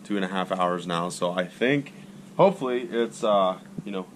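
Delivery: usual speaking voice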